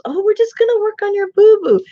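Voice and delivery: put-on high doting baby voice; baby talk to dog. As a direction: coo at the dog like a toddler. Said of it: "Richard goes".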